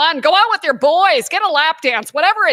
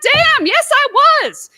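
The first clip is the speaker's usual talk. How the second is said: high, loud sassy comeback; defiant retort; 11 semitones higher, 5 dB louder than usual. Retold or imitated. Comic retorts to her jealous husband, high sassy comeback. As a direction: fire back at the jealous husband. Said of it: "I'm like".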